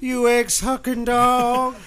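wrestler promo. Acted. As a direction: growl it like a heel. Wrestling trash talk promo.

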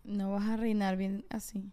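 whispered tease. Real, not acted. Teasing the cohost about being a bad king, whispered. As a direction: whisper the jab close to the mic.

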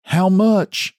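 high, frightened squeak; little man asking the hermit, scared; trembling. A narrator's reading. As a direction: ask it trembling with fear.

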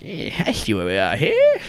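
high, put-on monster voice; odd, high creature character; stretched-skin creature. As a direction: speak high as if the skin were pinned back.

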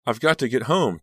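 high, loud, distressed plea; choked out. A narrator's reading. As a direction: force it out through rising panic. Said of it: "Danielle choked out".

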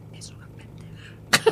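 gossiping whisper. Shown as a demonstration. Demonstrating señoras badmouthing someone, whispered. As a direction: breathe it out like a secret insult. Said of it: "bajas automáticamente el tono de voz".

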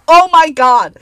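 loud, high, fed up; exasperated outburst. Real, not acted. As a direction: groan it out loud.

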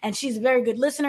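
usual speaking voice

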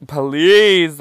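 loud, high, drawn-out please; hammed-up begging. Answering the hookup's request to record, hammed-up begging. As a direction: beg loudly, overdoing it for laughs. Begging, reading own message aloud.

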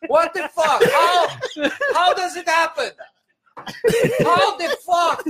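hammed-up angry neighbor shouting through laughter; baffled Armenian neighbor. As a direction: squawk it like a bewildered old man. Neighbor confused by rain.

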